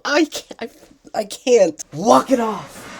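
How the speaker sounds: high, loud, overwhelmed cries; overwhelmed yelling. Real, not acted. Fan calming herself after yelling about the show, overwhelmed. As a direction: shout it out, too worked up to finish. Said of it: "gonna hear me yelling".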